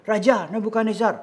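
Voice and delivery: high, loud appeal to the throne; acted scheming courtier's petition; plotters petitioning a king. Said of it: "bilang ke raja, menghadap raja".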